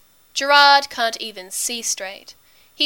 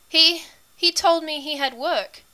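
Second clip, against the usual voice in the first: high, halting protest; weakly; 6 semitones higher than usual. A narrator's reading. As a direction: protest weakly, stumbling on the first word. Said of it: "Gerard says weakly".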